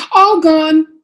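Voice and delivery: high, loud all-clear; trumpeted announcement. Acted. Parent reassuring child about monsters, trumpeting the all-clear. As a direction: trumpet it out brightly. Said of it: "I trumpet".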